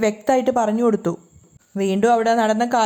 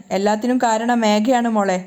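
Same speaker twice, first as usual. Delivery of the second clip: ordinary delivery, nothing marked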